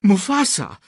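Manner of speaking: high-pitched calling out; urgent call. A narrator's reading. Scar runs up to his brother Mufasa, calling urgently. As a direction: call his name in alarm. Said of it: "rief er".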